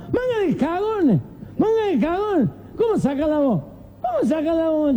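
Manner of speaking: put-on high, indignant drunk. Drunk scolding cowardly men, high and indignant. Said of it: "Y un borracho dice".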